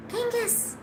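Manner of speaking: high, soft falling call; cooing pet's name. Real, not acted. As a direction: softly squeak the pet's name.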